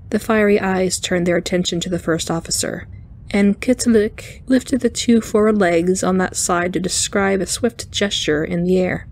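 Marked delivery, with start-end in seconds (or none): none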